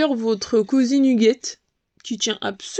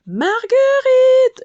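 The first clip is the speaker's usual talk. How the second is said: high, loud, drawn-out call; 8 dB louder, much slower than usual. A narrator's reading. The worried lady searching from room to room, calling the queen's name. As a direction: call her name across the hive. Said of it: "répéta Églantine".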